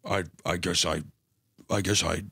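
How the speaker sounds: low hesitant mock mumble; mock Oppenheimer. Mocking Oppenheimer caving to the official, low and hesitant. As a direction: stammer it low, lightly voiced. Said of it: "And he's like".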